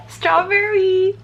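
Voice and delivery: high, drawn-out, playful squeal. Real, not acted. Showing off earrings to viewers, playful high squeal. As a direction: sing out the word in a high, silly voice.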